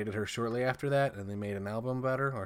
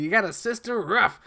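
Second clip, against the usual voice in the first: higher, louder mock jeer; retold catcall, raised and punchy; heckling trash talk; 10 semitones higher, 7 dB louder than usual. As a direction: taunt someone from a passing car, imitating a loudmouth. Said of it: "starts talking shit to her".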